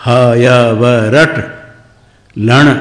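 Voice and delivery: ह, य, व, रट, लण slowly, one by one; every final letter sounded. Shown as a demonstration. Teacher drilling the sutras, ह, य, व, रट, लण distinctly spaced. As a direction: separate each letter, firm ट in रट and ण in लण. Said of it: "ऐसे अंत्य वर्ण".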